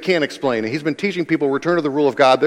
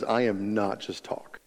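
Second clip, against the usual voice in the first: low, slow and soft, each word weighed; understated, deliberate assertion; quiet firmness; 4 semitones lower, 9 dB quieter than usual. Retold or imitated. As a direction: state it with hushed, measured authority. Jesus's firm answer, quietly retold.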